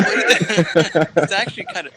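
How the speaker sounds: slow, high words through giggles; laughing. Real, not acted. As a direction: concede it while laughing. Laughing reply to the joke.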